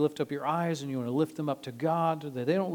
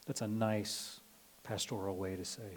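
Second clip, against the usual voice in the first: ordinary talk, nothing marked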